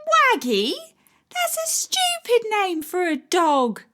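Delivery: put-on a boy scoffing at a silly name; child teasing; scornful little boy.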